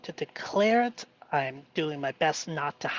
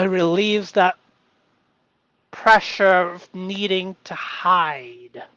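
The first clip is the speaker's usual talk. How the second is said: ordinary delivery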